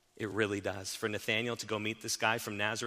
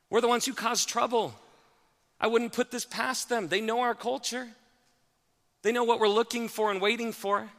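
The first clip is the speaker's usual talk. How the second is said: higher and louder, an anxious rush of worry; Nathanael's anxious fears; 9 semitones higher, 4 dB louder than usual. Acted. Preacher voicing Nathanael fearing a Roman trick, anxious and high. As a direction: voice the nervous doubter spiralling into suspicion. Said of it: "Nathanael probably thought things like this".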